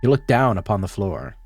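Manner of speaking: low, measured storybook narration; story narration. A narrator's reading. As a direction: read it like a quiet tragic tale. Narration of game text.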